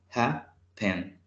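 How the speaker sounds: happen broken into HAP, pen; split into syllables. Shown as a demonstration. Teacher counting two syllables for learners, syllable by syllable. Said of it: "el acento lo tiene al inicio".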